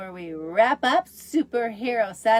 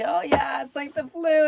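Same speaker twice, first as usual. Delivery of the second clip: higher, louder mock-agreeing echo; mock agreement; 6 semitones higher, 7 dB louder than usual. Patient jokingly echoing the doctor's flu line, mock agreement. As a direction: play along in exaggerated agreement.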